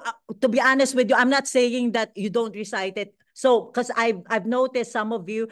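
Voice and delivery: ordinary talk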